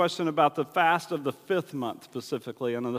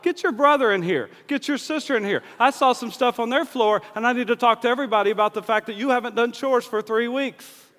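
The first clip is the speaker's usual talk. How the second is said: higher, louder mom calling everyone in; mock-angry mom, higher and louder; full mom; 4 semitones higher, 5 dB louder than usual. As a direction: summon the whole family like an angry mom. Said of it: "goes full mom on him".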